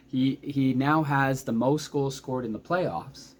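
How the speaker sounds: ordinary talk, nothing marked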